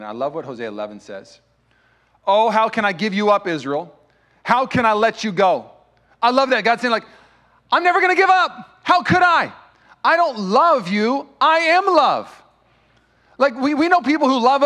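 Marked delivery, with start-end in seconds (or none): 2.3-9.5 loud, high preaching at full force; booming pulpit delivery, pleading and urgent; fiery preaching. Acted. 10.0-12.4 very slow and weighty, each word landed; slow right down and lean on every word; deliberate loud drawn-out proclamation; slow emphatic. Acted. God's words, slowed for weight.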